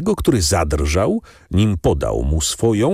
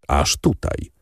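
flat confirmation; 2 semitones lower, slower than usual. A narrator's reading. Bandit confirms flatly. (spokojne, niższe potwierdzenie „Aż tutaj”)

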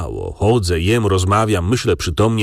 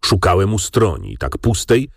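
ordinary delivery

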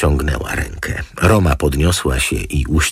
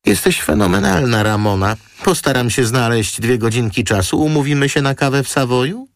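much higher, quick gushing invitation; gushing model; 9 semitones higher than usual. Acted. Model gushing thanks to the lawyer, high and quick. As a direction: gush over her, then rush the invite.

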